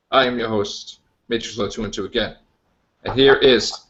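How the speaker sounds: ordinary delivery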